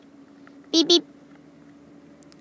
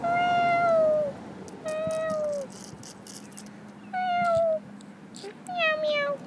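high squeaky kitten meows; kitty meows; 13 semitones higher, much slower than usual. Pretend. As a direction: play the little cat meowing. Kitten keeps meowing.

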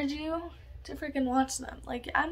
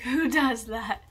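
slow, louder, laughing through it; 6 dB louder, slower than usual. Real, not acted. Laughing at her own forgetfulness to the camera, slowed. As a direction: laugh at yourself as you ask. Repeats question, laughing at herself.

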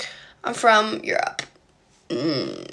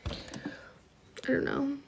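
whispering; 3 semitones higher, 14 dB quieter, much slower than usual. Real, not acted. Hushed unsure aside.

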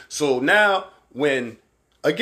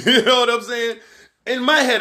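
ordinary talk

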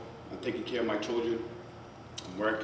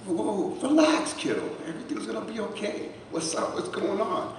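surprised dad; 11 semitones higher than usual. Retold or imitated. Dad calming son on phone.

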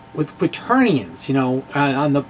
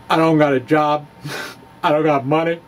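milk it like a starving genius; mock melodrama with a laugh in it; overacted. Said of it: "let me not overact too much here".

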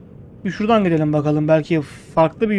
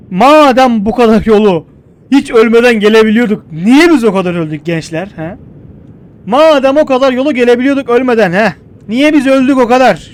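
loud, exasperated scolding; loud complaint; 4 semitones higher, 8 dB louder than usual. Real, not acted. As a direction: rant at the group, fed up. Öldükleri için sitem ediyor. (yüksek sesle sitem ederek)

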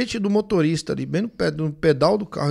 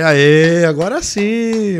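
jubilant cheer; 9 dB louder, much slower than usual. Retold or imitated. Celebrating finding the stone.

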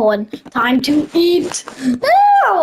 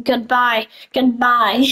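normal talking voice

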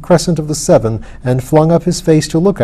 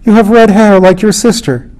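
raised, urgent plea; emotional outburst, building to a high finish; impassioned cry; 6 semitones higher, 5 dB louder than usual. A narrator's reading. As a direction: cry it out, climbing higher and louder. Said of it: "he cried".

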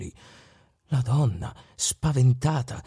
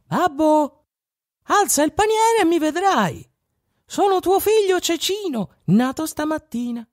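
tiny little voice. Acted. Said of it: "veniva una vocina".

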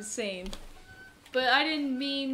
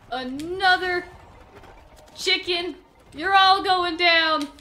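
loud, slow, punched angry declaration; furious rant; 7 dB louder than usual. Real, not acted. Player fed up with monsters, slow loud furious rant. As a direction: spit it out as a vow of revenge.

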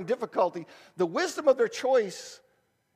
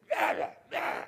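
mock grumbling; 4 dB quieter than usual. Mocking grumbling sufferer.

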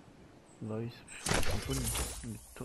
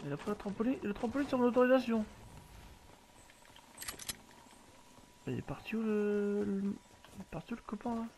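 indignant; 7 semitones higher than usual. Real, not acted. Indignant over stolen trampoline.

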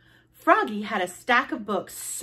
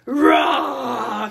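loud, long roar; dinosaur roar; 3 semitones higher, 7 dB louder than usual. Acted. Froggy pretends to be T-Rex, roaring. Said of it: "cried Froggy".

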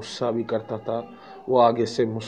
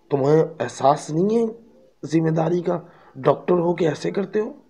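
angry father; 6 semitones higher than usual. Retold or imitated. Father scolding the late doctor, raised and angry. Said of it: "उसका बाप लड़के का कहता है".

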